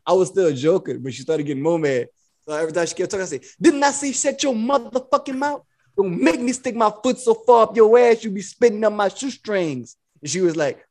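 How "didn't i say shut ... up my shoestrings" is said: higher-pitched mock pimp scolding; put-on pimp bossiness; Iceberg Slim. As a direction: act out a pimp telling someone off. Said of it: "I was talking like Iceberg Slim".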